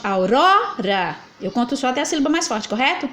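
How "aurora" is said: Aurora drawn out slowly, leaning on the ro; stressed syllable shown. Shown as a demonstration. Teacher showing the strongest syllable, slow and stressed. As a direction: stretch Aurora to show where the stress falls. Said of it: "Lembra a sílaba mais forte?".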